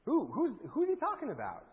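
high, soft, halting questions; puzzled onlookers. Acted. Preacher voicing sorry folks looking behind their shoulders, puzzled. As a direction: wonder aloud who is meant, stumbling over "who". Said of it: "imagine them looking behind their shoulders".

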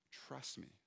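very soft voiced trust me; barely above a murmur, gentle and sure; hushed reassurance. God's voice calming the anxious pastor, very soft. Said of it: "what God was speaking to me".

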